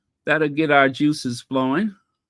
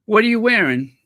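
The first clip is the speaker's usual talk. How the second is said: louder, lightly posh query; mock fashion question; 4 semitones higher, 7 dB louder, faster than usual. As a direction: ask like at a gala. Acted posh fashion exchange.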